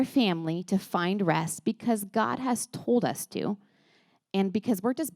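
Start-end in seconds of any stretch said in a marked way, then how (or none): none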